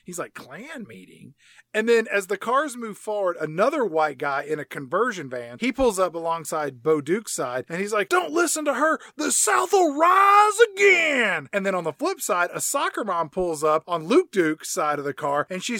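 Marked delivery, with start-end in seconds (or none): 0.3-1.3 slow, very soft baffled question; imitating a puzzled good old boy; totally confused. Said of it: "like, totally confused". 8.1-11.5 high, loud rallying cry; hollering Southern zealot. Retold or imitated. Imitated man hollering from a van.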